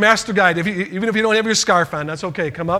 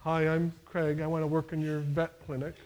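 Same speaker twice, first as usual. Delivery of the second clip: much softer, meek introduction; under-confident interview mumble; timid job applicant; 11 dB quieter than usual. Shown as a demonstration. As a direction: mumble it shyly, barely audible. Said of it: "you don't wanna go".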